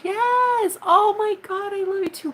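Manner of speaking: very high, delighted squeal of affection; excited gushing. Real, not acted. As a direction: gush love back, pitch soaring. Gushing back at a viewer.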